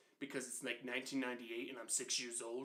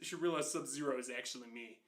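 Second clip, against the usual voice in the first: ordinary talk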